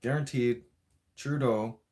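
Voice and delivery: ordinary talk, nothing marked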